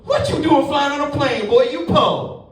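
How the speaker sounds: high, sassy gate agent voice; gate lady voice. Retold or imitated.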